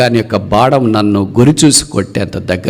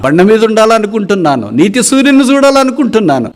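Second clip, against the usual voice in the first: much higher and louder plea; pleading prayer cry; 13 semitones higher, 4 dB louder than usual. Acted. A fallen believer prays to the Lord, crying out high and loud. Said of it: "ప్రభు వైపు చూస్తూ".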